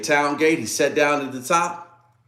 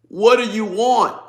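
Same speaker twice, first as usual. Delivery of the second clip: higher, louder, slow put-on voice; exasperated demand; 8 semitones higher, 5 dB louder than usual. Spouse demanding answers in mock quarrel, high loud slow. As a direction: throw it back as a fed-up partner.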